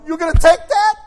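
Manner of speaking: the Spirit goading him to act; challenging inner voice. Retold or imitated. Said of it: "The Spirit of God is going".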